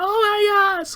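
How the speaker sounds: very high, loud shriek of shock; mom's high-pitched gasp of horror. Retold or imitated. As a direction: squeal it like a horrified mother. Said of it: "The mom's like".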